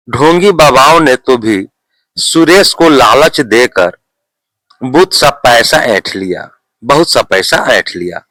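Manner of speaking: ordinary delivery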